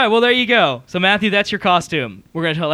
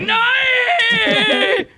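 mock shout. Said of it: "Be like".

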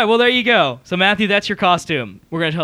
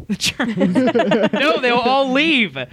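laughing; 4 semitones higher, slower than usual. Real, not acted. Laughing at the German joke.

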